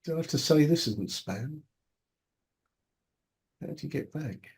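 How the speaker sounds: normal talking voice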